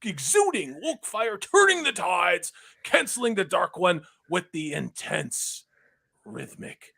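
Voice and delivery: booming game show announcer; big dramatic announcer read. Pretend. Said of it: "Can I do my announcer voice for this one?".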